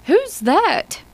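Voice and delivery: high, unsure faltering question; a lost voice asking in the dark. Acted. Lespierre, drifting away, asks who calls, faltering. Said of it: "Lespierre's faltering voice".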